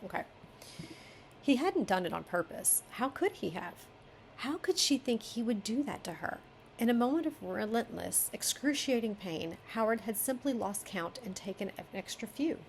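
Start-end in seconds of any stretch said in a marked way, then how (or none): 1.4-12.6 story narration. Acted. Editor reading the chapter aloud, story narration. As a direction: read the character's hurt into the prose.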